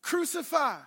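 loud, high cry of crucify; crowd shouting. Acted. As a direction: shout it like the angry mob.

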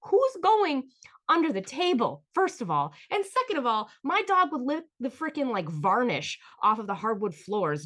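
high, loud indignant comeback; indignant retort. Retold or imitated. Answering her mom at the table, indignant. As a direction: fire back in disbelief. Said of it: "I'm just like".

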